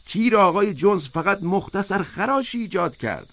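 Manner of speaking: high, emphatic protest; insistent high-pitched oration, not a full shout; crying out. A narrator's reading. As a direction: argue it high and forcefully, like a rousing speech. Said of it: "اسکویلر فریاد کشید".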